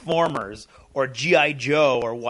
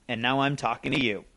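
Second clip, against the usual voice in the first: ordinary talk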